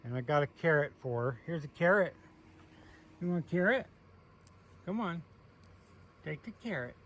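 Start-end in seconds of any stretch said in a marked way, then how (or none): 3.2-6.9 coaxing pet talk. Real, not acted. Coaxing goat to eat.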